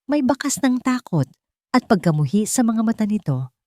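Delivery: usual speaking voice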